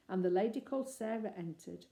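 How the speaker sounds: usual speaking voice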